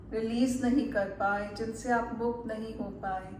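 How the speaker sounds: calm meditation guide, unhurried with long pauses; measured guided-relaxation voice, lingering on soothing words. Acted. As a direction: ease the listener into relaxation, phrase by phrase.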